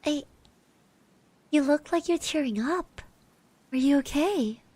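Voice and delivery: ordinary talk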